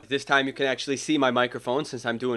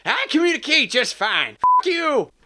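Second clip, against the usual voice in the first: much higher, snippy bunny voice; Easter Bunny; 13 semitones higher, 5 dB louder than usual. Acted. Easter Bunny retorting. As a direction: snap it back defensively. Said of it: "last of his lines".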